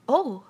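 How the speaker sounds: loud, high "oh"; cry of pain. A narrator's reading. Pooh tugged by all his friends, crying out. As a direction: cry out as if yanked. Stuck Pooh being pulled hard.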